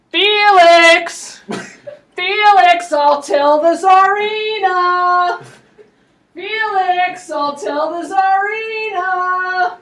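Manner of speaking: loud, drawn-out singsong yell; slow sung-out threat, loud. Retold or imitated. Rasputin running across the courtyard, yelling a singsong threat. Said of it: "he's yelling".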